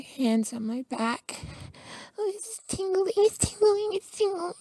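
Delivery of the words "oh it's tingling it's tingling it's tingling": much higher, climbing on each repeat; voice goes higher. Acted. Boyfriend reacts to partner's touch with a much higher voice. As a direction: let the pitch jump up as the tingle hits. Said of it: "my voice goes higher when you touch me".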